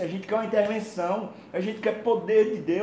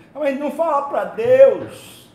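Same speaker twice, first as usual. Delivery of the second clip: louder, higher and slower, hammering the point home; emphatic outcry; 7 semitones higher, 11 dB louder, slower than usual. Real, not acted. Preacher to the congregation, a loud slow emphatic outcry. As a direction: cry it out to the congregation. Preacher stressing praying to God.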